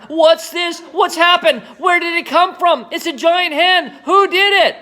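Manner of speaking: high, loud shouting, all worked up; excited shouting. Acted. Mister Cranky shouting wildly at the giant hen. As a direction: fire the questions in a frantic shout. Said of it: "he cried".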